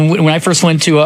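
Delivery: ordinary talk, nothing marked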